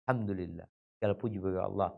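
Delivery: soft, low, almost to himself; hushed thanks. Acted. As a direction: murmur the praise in quiet awe. Survivor praising God, hushed.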